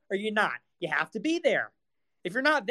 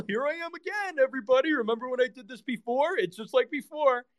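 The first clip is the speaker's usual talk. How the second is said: much higher hammed-up welcome with a laugh; mock cheery host; 7 semitones higher than usual. Streamer parodying an eager host to his fans, high mock cheer. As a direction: fake the excited streamer.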